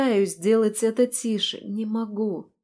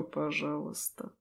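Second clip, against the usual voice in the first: low, calm, close to the ear; unhurried, teasing calm; 5 semitones lower, 11 dB quieter than usual. Acted. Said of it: "Голос в самое ухо кажется абсолютно спокойным".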